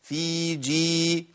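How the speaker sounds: level, evenly measured hold on each ي of "في جي"; balanced lengths. Shown as a demonstration. Teacher balancing two long vowels, very slow "في جي". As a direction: chant "في جي" very slowly, both "ee" vowels equally long. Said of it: "أوزنهم مع بعض".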